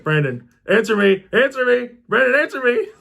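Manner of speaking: urgent repeated calls climbing high; mock panicked calling. Brother calling to someone not answering, retold rising panic.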